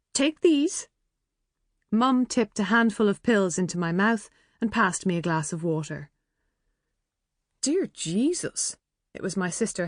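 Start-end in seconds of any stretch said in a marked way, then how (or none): 0.1-0.9 mum's voice. A narrator's reading. Mum giving pills. 7.6-8.8 appalled. A narrator's reading. Helen appalled by decor.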